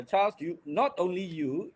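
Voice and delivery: usual speaking voice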